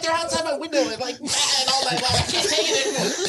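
high, pleading Australian whine; mock Australian roommate begging to eat the goats; whiny Australian mate. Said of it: "He's Australian".

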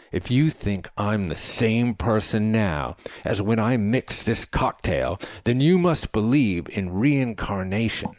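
high, insistent rant, slowing at the end; Chadwick, impassioned. A narrator's reading. Man tells companion his cells vanish, impassioned. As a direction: punch out each loss, then draw out the close.

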